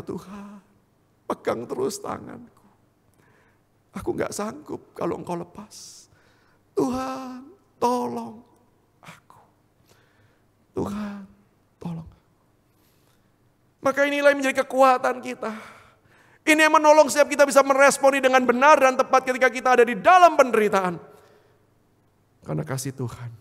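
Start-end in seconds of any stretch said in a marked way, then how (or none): 0.1-12.1 pleading prayer. Acted. Believer asking God to hold his hand, pleading prayer. 13.8-21.0 loud, high, ringing rhetorical questions; full-force preaching. Real, not acted. Preacher proclaiming God's unchanging love to congregation, full-force preaching.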